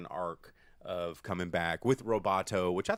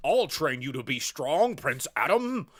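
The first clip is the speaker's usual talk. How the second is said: loud, higher hammed-up trainer voice; gruff mentor; 7 semitones higher, 8 dB louder than usual. Man-at-Arms promising to train Prince Adam, hammed-up loud mentor. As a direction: play a cartoonish drill mentor.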